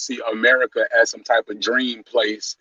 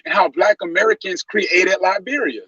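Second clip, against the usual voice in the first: pitched-up indignant rant; heated rant; 9 semitones higher than usual. Real, not acted. Heated rant about Africans.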